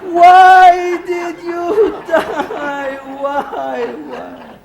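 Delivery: high drawn-out wail that dies away; mock wail fading out. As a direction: wail it, then let it fizzle. Callback of the wail.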